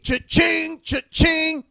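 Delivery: high, loud ringing cha-ching; sung-out cash-register cha-ching. Pretend. Reader getting paid, gleeful cash-register imitation. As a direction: ring it up like a till.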